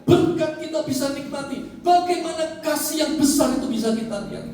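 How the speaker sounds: loud, high, rhythmic proclamation; fervent preaching. Acted. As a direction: build the refrain with full conviction. Preacher proclaims God's love. (khotbah lantang penuh semangat)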